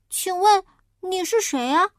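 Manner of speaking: high, polite little pig asking; a small pig politely asking a stranger; polite piglet. Acted. Piglet asks the big wolf who he is, high and polite.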